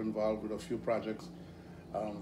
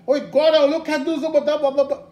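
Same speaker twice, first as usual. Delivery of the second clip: angry Trinidadian rant, much higher and louder; Trinidadian outburst; 16 dB louder than usual. Retold or imitated. Angry manager slipping into Trinidadian, loud Trinidadian rant. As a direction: let the Trinidadian fly out angrily. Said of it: "the Trinidadian just fly out of her mouth".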